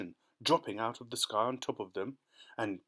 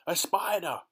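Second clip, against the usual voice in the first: higher, louder shriek of disgust; alarmed cry; 6 semitones higher, 5 dB louder than usual. Acted. Aunt Sponge spots the spider, crying out. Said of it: "she cried".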